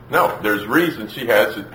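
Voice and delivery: normal talking voice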